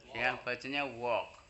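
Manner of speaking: ordinary talk, nothing marked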